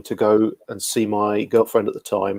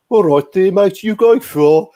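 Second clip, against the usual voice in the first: high, loud mock Brummie accent; 8 semitones higher, 6 dB louder, slower than usual. Train passenger greets a traveller, mock Brummie accent. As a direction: imitate a thick Brummie accent, pitched up and drawn out. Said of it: "his accent".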